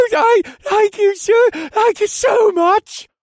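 very high, loud, slow gushing thanks; gushing thief. Acted. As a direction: gush with gratitude. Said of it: "Hal gushed".

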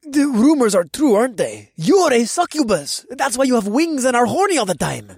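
high and loud excited voice. A narrator's reading. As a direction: blurt it out thrilled. Tony excited discovery.

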